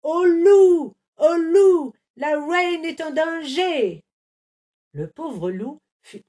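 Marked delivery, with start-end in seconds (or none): 0.0-0.9 high, loud alarm cry; panicked guard shouting for help; guard yelling. A narrator's reading. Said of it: "le garde se mit à hurler". 1.2-4.0 high, loud, frantic alarm; guard bellowing that the queen is in danger; guard yelling. A narrator's reading. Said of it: "le garde se mit à hurler".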